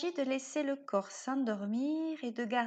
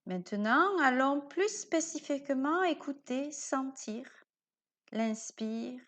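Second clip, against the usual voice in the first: guided meditation. Acted. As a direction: lull the listener towards sleep. Guide une séance de yoga nidra. (voix de méditation guidée, lente et douce)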